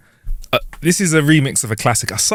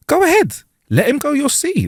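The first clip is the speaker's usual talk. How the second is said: eager lawyer; 13 semitones higher than usual. Acted. Lawyer voiced in joke.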